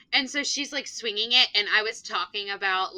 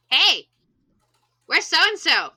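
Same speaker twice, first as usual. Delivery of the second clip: louder, raised-pitch teacher call; 6 semitones higher, 7 dB louder than usual. Retold or imitated. Teacher asking the class after a missing pupil, calling out.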